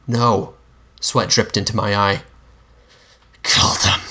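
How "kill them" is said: forceful, hissing whisper; hissed. A narrator's reading. Mister Monster demands that the narrator kill, hissing. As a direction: hiss it, all breath and menace. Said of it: "he hissed".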